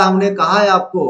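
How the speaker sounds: usual speaking voice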